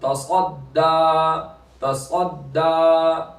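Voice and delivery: slow तसद्दा with दा held long; stretched final दा. Shown as a demonstration. Teacher shows one alif's length, slow तसद्दा with long ending. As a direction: draw out the last आ of तसद्दा. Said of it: "एक अलिफ के बराबर खींचेंगे".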